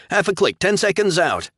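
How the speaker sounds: high, loud urgent callout. A narrator's reading. Pilot calling range to captain, loud and urgent. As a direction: bark the range out fast.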